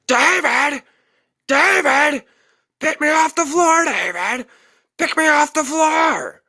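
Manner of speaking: put-on high, loud desperate old man's shriek; mock helpless old man screaming high. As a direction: wail for David from the floor. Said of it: "He was screaming".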